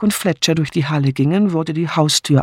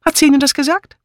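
put-on dramatic accented maid in broken German; foreign housekeeper, agitated; 8 semitones higher than usual. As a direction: plead your fear with swinging, higher pitch.

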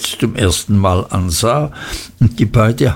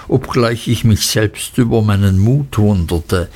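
ordinary delivery, nothing marked

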